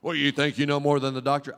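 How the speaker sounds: usual speaking voice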